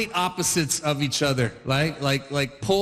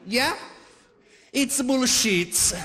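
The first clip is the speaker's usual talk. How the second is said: high, slow, dismissive Italian dad; Italian accent; 7 semitones higher, much slower than usual. Retold or imitated. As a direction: play a scornful father with a thick Italian accent. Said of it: "said it like my Italian father".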